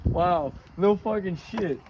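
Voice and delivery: normal talking voice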